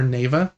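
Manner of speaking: Neva said as NAY-vuh; long-a pronunciation. Shown as a demonstration. Offering another guess at the name, Neva as NAY-vuh. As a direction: say Neva with an ay in the first syllable. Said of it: "couldn't tell how she was pronouncing it".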